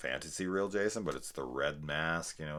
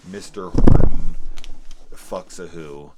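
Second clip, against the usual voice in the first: loud exclamation; 21 dB louder than usual. Real, not acted. Exclaims the infamous episode name.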